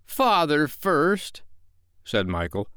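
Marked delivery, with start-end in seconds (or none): 0.0-1.4 put-on high, slow small boy's voice; suspicious child. A narrator's reading. Michael insisting Father goes first, suspiciously. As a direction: answer back as a wary little boy. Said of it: "who was of a suspicious nature".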